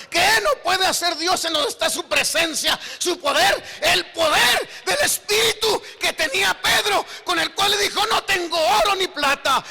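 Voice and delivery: shouted, high-pitched sermon climax; full-force preaching. Real, not acted. Preacher proclaiming Peter's miracle to the congregation, shouted fervent preaching. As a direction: thunder out the power of God.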